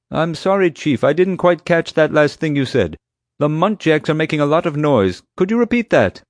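loud, high-pitched mock apology; feigning not hearing. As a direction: call over a racket, playing innocent. Feigns a bad radio connection.

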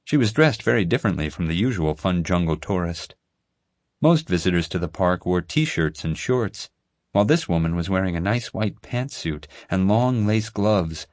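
ordinary delivery

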